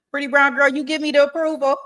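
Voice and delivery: high, loud hype; excited. Real, not acted. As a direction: hype yourself up loudly.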